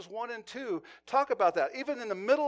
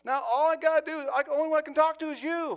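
exasperated man; 8 semitones higher, 4 dB louder than usual. Retold or imitated. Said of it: "He goes".

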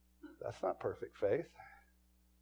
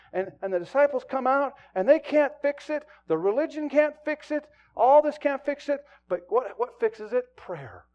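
loud, driving sermon delivery; full-force preaching; 8 semitones higher, 9 dB louder than usual. Acted. Pastor preaching hard to his church, loud and driving.

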